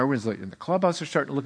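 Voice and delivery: ordinary talk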